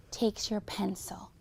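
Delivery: soft unvoiced whisper; teacher whispering to the class. Real, not acted. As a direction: lean in and whisper it. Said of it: "teacher whispers to class".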